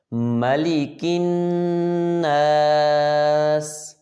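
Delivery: slow drawn-out tajweed chant; Quran recitation. Acted. Teacher reciting for pupils to repeat, slow chant. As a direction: recite each syllable long and even, like in the mosque.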